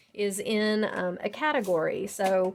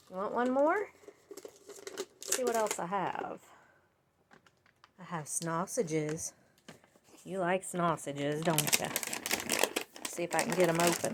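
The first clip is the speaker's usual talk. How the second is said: talking to a dog; 3 semitones lower, 5 dB quieter than usual. Real, not acted. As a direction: coax the dog gently. Talking to her dog Sadie.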